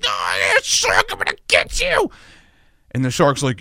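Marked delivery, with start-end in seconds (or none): 0.0-2.1 mock battle cry. Swimmer fighting the shark biting their torso, comic scream. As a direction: scream it like you're being bitten.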